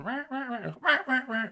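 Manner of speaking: high yelping rah rah rah like a hen; hen yelp imitation. Hunter showing his buddy how hens yelp back, in a high hen yelp. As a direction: mimic a hen's yelping cadence, high. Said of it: "So they're going".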